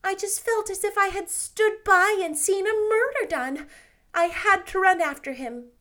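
lightly voiced anxious young woman; Anne, flustered girl. A narrator's reading. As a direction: hurry to mend it. Anne explains.

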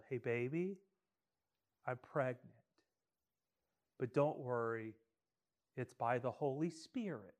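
hushed, careful news to husband; soft confession. Pretend. Wife telling husband she is pregnant, softly. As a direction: break it gently, almost under the breath. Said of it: "and you tell them".

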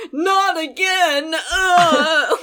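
high, drawn-out hammed-up wail with a loud groan; mock yell. Co-host mimicking Grandpa getting knocked out, hammed-up yell. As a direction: stretch it out, then groan in mock agony. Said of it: "it's a weird thing to yell".

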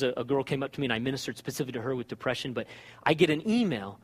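usual speaking voice

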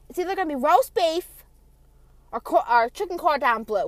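loud, high mom impression; imitated mom hollering. Mom's dinner options, imitated.

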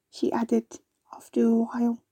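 usual speaking voice

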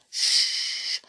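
long, urgent shush; alarmed hushing. A narrator's reading. Squirrel silences the noisy children, in alarm. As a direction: hush them sharply, alarmed. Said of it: "said the squirrel in alarm".

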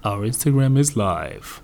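deep, slow radio announcer. Acted. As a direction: announce it like a DJ on air. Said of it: "I had to use my radio voice".

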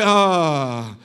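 long, low sigh of relish; satisfied "ah". Acted. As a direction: let out a drawn-out ah after tasting. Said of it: "you say".

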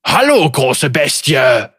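loud booming stone giant. A narrator's reading. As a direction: boom it out like a giant. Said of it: "polterte er".

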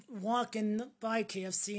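ordinary talk

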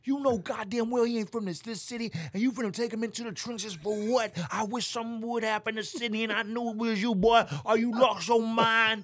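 high-pitched protective tirade; imitating mad Lee.

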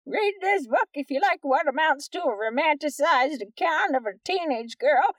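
high, lively mocking read-aloud. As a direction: read it like a snooty reviewer. Reads a review aloud in a voice.

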